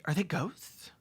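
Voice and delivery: ordinary talk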